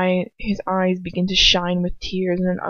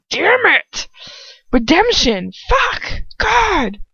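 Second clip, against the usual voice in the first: much higher, loud exasperated outbursts; real frustration; 10 semitones higher, 5 dB louder, much slower than usual. Real, not acted. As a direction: swear in genuine annoyance at the flub. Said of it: "reader frustrated at own stumble".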